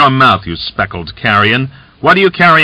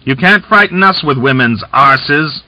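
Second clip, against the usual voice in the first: louder, higher jeering shout; merry shout; 7 semitones higher, 4 dB louder than usual. A narrator's reading. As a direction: shout it out with rough good humour. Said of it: "laughing, he shouted".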